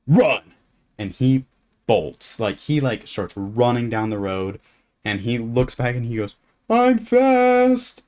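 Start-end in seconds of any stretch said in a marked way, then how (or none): 0.1-0.5 sudden loud command, raised pitch; mock menacing shout. Tall camper chasing off a small kid, mock-menacing shout. 6.7-8.0 put-on very high, loud kid squeal; imitating fleeing kid. Small kid running from a bigger camper, squealed boast.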